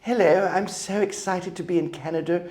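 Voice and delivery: high, prim mock posh English; posh English child. Young immigrant arriving in Canada, high posh English. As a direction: imitate an eager little English boy, very proper. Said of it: "I got there and I was like".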